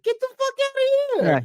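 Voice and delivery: very high, loud imitation of a movie character; hammed-up high-pitched exclamation; mock outraged yell. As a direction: squeal it out in disbelief, way up high. Imitating the art gallery guy.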